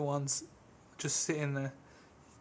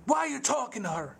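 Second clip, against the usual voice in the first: angry Curly; 8 semitones higher than usual. Retold or imitated. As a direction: snap at him like a jealous little tough. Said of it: "He's like".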